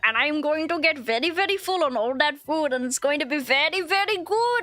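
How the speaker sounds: lilting mock Indian accent. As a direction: gush in a playful Indian accent. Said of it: "I'm just doing an accent".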